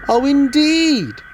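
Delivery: high, slow, drawn-out stern reproach; severe. Acted. Fat Controller scolds the sulky engines, severely. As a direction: stretch it out like a stern boss. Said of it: "said the Fat Controller severely".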